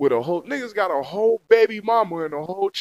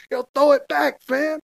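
calling out; 8 semitones higher, 5 dB louder than usual. Retold or imitated. Fishing call, raised.

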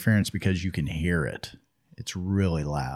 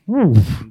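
loud, higher "woof" sound effect; a sudden whoosh of a cart stopping; imitated braking noise; 11 semitones higher, 10 dB louder than usual. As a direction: voice the abrupt stop as a noise.

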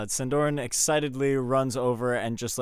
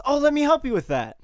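much higher, eager offer of help; eager helper; 6 dB louder than usual. Acted. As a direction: jump in keenly to help. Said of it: "runs over to him and says".

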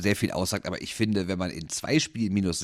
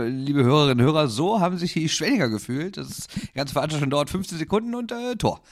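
announcer voice; 6 semitones higher, 3 dB louder than usual. Pretend. Mock address to listeners.

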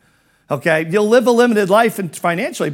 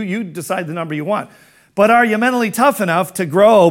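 usual speaking voice

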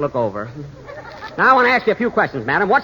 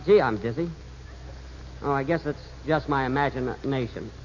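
dizzy mumble. Acted. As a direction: mumble it, sinking into dizziness. Dizzy and afraid on the bridge.

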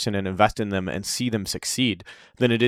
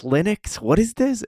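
rising disbelief; 11 semitones higher, slower than usual. Retold or imitated. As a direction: pitch climbing higher through the question. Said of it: "I'd never even heard this word before".

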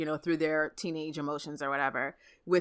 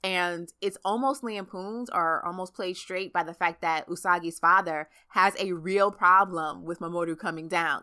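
ordinary delivery

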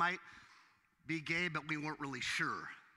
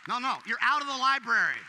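much higher, louder, insistent cry of no; outraged preaching; 7 semitones higher, 5 dB louder than usual. Real, not acted. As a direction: refuse it with rising indignation.

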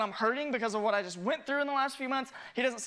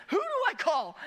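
higher, laughing panic; laughing mock panic; 8 semitones higher than usual. Imagined congregant wondering whom to report the pastor to, higher laughing panic. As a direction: ask it in amused alarm, higher. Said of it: "you'd be like".

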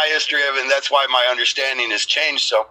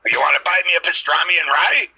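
loosely imitated comic voice, pitched up; raised mock-comedian voice; Gilbert Gottfried; 5 semitones higher than usual. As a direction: do the comedian's pestering bit, higher than usual. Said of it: "Like Gilbert Gottfried says it".

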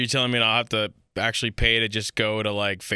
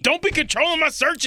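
mock outrage; 3 dB louder than usual. Real, not acted. Mock outrage at being controlled.

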